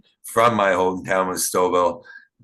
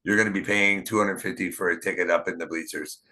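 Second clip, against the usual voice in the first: usual speaking voice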